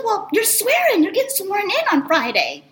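imitated upbeat, high-pitched announcement; retold chummy excitement. Representative telling her of swearing-in, mock chummy. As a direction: break good news as if obvious. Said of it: "as if we were, like, best friends".

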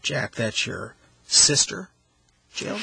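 slow, with "sister" drawn out before a pause; stress on SISTER. Shown as a demonstration. Host demonstrating his favorite example, stress on "sister". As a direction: stretch "sister", pause, then "Jill". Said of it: "by the way that we emphasize".

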